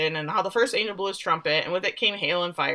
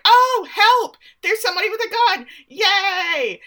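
much higher, louder, fast giddy cheer; overjoyed woman; 12 semitones higher, 6 dB louder than usual. Retold or imitated. Host imitating the woman thrilled to find a gun. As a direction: squeal with silly delight. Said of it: "makes the woman like".

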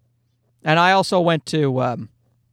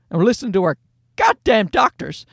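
ordinary delivery

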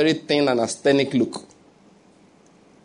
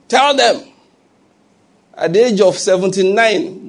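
raised, insistent pulpit voice; declaiming to a congregation; forceful preaching; 4 semitones higher than usual. Real, not acted. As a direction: preach it higher, driving it at the congregation.

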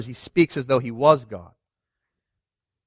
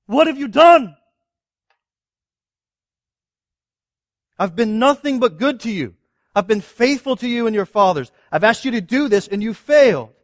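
higher, louder wounded rebuke; a grieved God asking why they betrayed him; hurt, confronting God; 6 semitones higher, 4 dB louder than usual. Retold or imitated. God confronting his disobedient people, hurt and raised. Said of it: "the exact same question that God asked".

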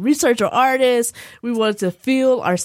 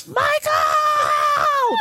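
high, drawn-out wail of a name; hammed-up high-pitched wail; imitated wailing yell. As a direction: cry out like a grieving mother. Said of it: "my mother's just yelling".

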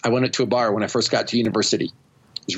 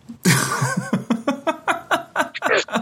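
high burst of laughter; 14 semitones higher than usual. Real, not acted. Laughing at his own shirt-ripping story.

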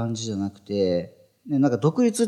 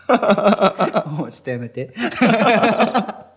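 laughing; 11 semitones higher, 5 dB louder, much slower than usual. Real, not acted. Teased about the sneeze.

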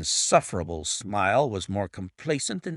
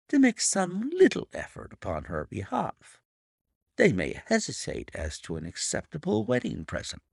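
higher, silky villain voice; smug blackmailer voice; 13 semitones higher than usual. Acted. Narrator voices Milverton.

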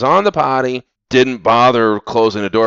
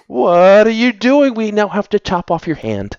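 mock parent yell; 10 semitones higher than usual. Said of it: "I'm like".

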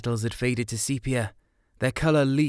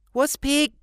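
put-on high childish blurted question; little brother's question; 5 dB louder than usual. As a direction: pipe up like a small curious kid.

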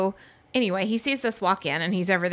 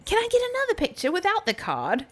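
imitating British guy; 12 semitones higher than usual. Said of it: "So then he's like".

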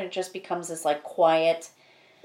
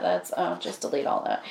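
whispered aside; 3 semitones lower, 7 dB quieter than usual. Real, not acted. Aside to editor.

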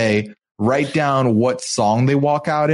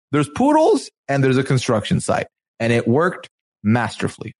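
ordinary delivery

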